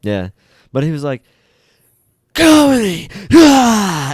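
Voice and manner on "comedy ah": very high, loud hoarse yell; raspy drill instructor call. Retold or imitated. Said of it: "so raspy".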